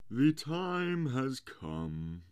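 slow, high, loud proclamation; pompous walrus. A narrator's reading. Walrus opens his speech to the oysters, pompous proclaiming. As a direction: draw it out like a grand announcement.

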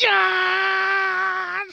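drawn-out, high, joyful yeah; stretched excited yell. Real, not acted. Fan reliving the match, slow ecstatic shout. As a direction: let the yeah soar and hang.

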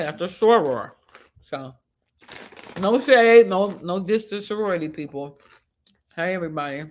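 normal talking voice

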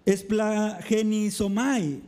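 "Esplagenizomai" said slowly, syllable by syllable; Greek word, carefully spoken. Shown as a demonstration. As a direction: spell out "Esplagenizomai" slowly and clearly. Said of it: "palabra difícil de pronunciar". (pronunciación lenta de "Esplagenizomai")

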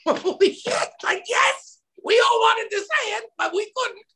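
high-pitched excited outburst; excited exclamation. Retold or imitated. Crew reacting to the soldier's outburst, excited and high. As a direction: blurt it out in awe and relief. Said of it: "everyone kinda went".

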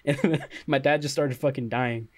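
usual speaking voice

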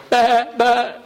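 light, calm answering bleat, a hint of a ewe; mother sheep bleat. Mother sheep answering her lamb, lightly voiced bleat. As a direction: answer the little one gently as a ewe.

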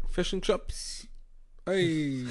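light whispered mock Kiwi accent. A fan mocking the Kiwi ref in a faint Kiwi accent. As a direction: toss off a quiet Kiwi-accent "Fish and chips". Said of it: "He'd be like".

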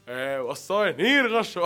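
loud norteño comedian yell; imitated shouting. Mimicking northern comedian friends, loud shouting. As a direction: call out loudly in norteño style.

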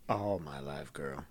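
low, soft smoky drag voice; low-pitched, hushed sassy reply; smoker's voice. Pretend. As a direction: play the lifelong smoker, low and quiet. Answers joke about smoker's voice.

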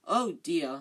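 plain reading voice

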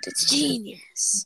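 very slow, whispery gloating; crazed boss. Retold or imitated. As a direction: draw it out in an unhinged, airy voice. Crazed boss's self-congratulation.

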